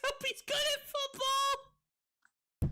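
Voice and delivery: high squealing laugh-talk; laughing hard. Real, not acted.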